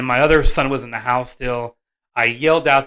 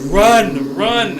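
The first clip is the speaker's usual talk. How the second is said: raised, louder warning cry; retold warning shout; 6 semitones higher, 6 dB louder than usual. Country neighbor warning of wasps, retold urgent shout. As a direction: call out like an alarmed neighbor.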